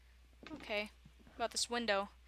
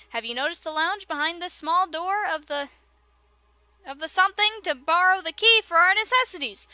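[name]'s odd voice; 3 semitones higher, 6 dB louder than usual. Acted. Gamer voices a pompous professor summoning his colleagues. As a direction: play the grand old professor addressing colleagues. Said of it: "Why does [name] have this voice?".

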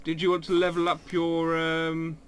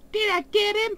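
high-pitched squeak. Real, not acted. Repeats question much higher.

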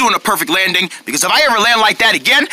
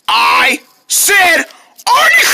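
exasperated shout; 5 semitones higher, 3 dB louder, much slower than usual. Pretend. Answering "what did you say" for hours, exasperated shout. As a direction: build to a high, loud yell on the name.

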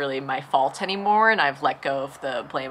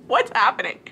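higher, louder, laughing in disbelief; exasperated laugh; 8 semitones higher, 4 dB louder than usual. Retold or imitated. Reliving paying the toll, laughing in disbelief.

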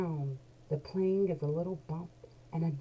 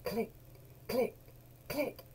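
click sounds; 6 semitones higher, 3 dB quieter than usual. Acted. Seatbelt sound.

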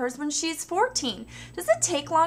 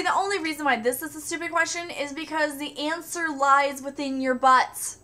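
ordinary delivery

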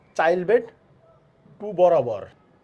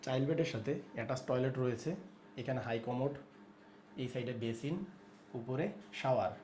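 very soft, lower hushed voice; speaking softly; 3 semitones lower, 10 dB quieter than usual. Real, not acted. Host showing child's toilet while baby sleeps, speaking softly. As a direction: quietly list the features without waking anyone. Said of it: "আস্তে আস্তে কথা বলছি".

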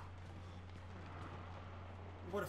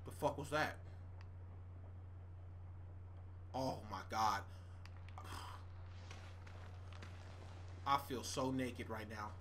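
low, deflated mutter; quiet defeated grumbling; 8 semitones lower, 15 dB quieter than usual. Real, not acted. Quiet dismay.

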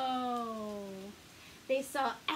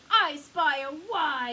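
loud excited shipmate call; a shipmate's eager yell; 2 semitones higher, 8 dB louder than usual. A narrator's reading. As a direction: shout it from the deck. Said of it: "yelled a shipmate".

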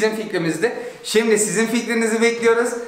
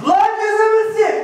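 exasperated shout; 13 semitones higher, 4 dB louder than usual. Real, not acted. Water dripping, demands eyes wiped.

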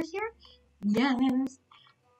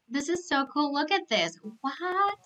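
ordinary talk, nothing marked

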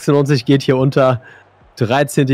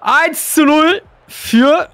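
commentator goal call; 3 dB louder, much slower than usual. Acted.

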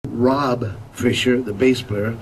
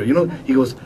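ordinary delivery

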